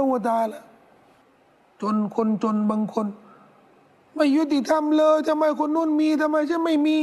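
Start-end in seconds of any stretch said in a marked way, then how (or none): 3.6-7.0 high, slow mock whine about unfairness; whining complainer. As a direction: imitate a poor man griping, pitched up and drawn out. Imitating a poor person complaining. (เลียนเสียงคนจนบ่นโอดครวญ เสียงสูงลากยาว)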